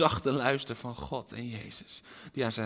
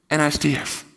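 louder firm statement; spoken firmly; 5 semitones higher, 12 dB louder than usual. Real, not acted. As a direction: state it with weight. Repeated line, loud then whispered. (stevig uitgesproken)

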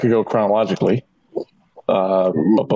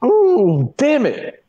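frustrated ooh; 11 semitones higher, much slower than usual. Real, not acted. Struggling to recall answer.

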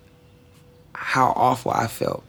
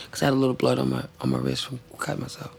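usual speaking voice

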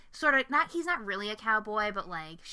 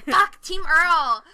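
slow, loud, higher mock outrage; mock dismissal; 8 semitones higher, 14 dB louder, much slower than usual. Real, not acted. Host rejecting cohost's pick Earl, slow loud mock outrage. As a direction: drag out the dismissal loudly.

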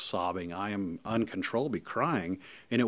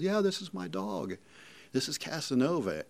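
put-on higher, proud young voice; a teen proudly introducing his dog to Jeff; imitating the boy; 8 semitones higher than usual. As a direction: play the son showing off his dog. Grown son shows his dog.

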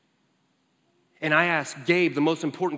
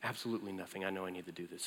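hushed earnest Jeff; 7 semitones lower, 13 dB quieter, faster than usual. Retold or imitated. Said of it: "And he goes".

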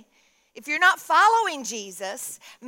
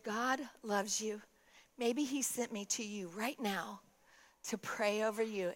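tender reassurance; 3 semitones lower, 12 dB quieter than usual. Retold or imitated. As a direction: reassure softly, as to someone crying. Pastor comforts the crying woman.

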